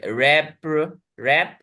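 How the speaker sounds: रैप as a separate chunk; spoken in segments. Shown as a demonstration. Teacher breaking a big word for students, रैप as one segment. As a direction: say रैप alone, as one detached syllable. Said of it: "बड़े बड़े शब्दों को हम खंड में बोलेंगे".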